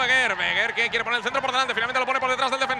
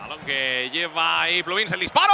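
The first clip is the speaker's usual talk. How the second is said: rising shouted goal commentary; breakneck goal commentary; 5 semitones higher, slower than usual. Real, not acted. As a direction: call the goals at full tilt.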